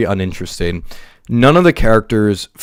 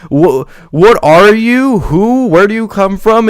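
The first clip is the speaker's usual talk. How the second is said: high, loud, exaggerated bafflement; mock surprise; 8 semitones higher, 4 dB louder than usual. Mock-baffled viewer asking a forgotten character who they are, high and loud. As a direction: ham up the confusion, pitched way up. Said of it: "and it's like".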